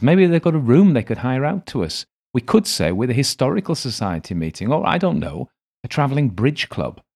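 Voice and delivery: playful woman. A narrator's reading. Ailsa grins, playful suggestion.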